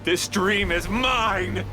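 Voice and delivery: high, slow, triumphant declaration; villain claiming the dream; possessive villain cry. Acted. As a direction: draw it out in triumph.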